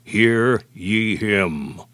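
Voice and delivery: very slow, solemn command; slow, weighty divine command; solemn voice of God. Retold or imitated. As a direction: draw out each word like a decree. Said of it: "when he said".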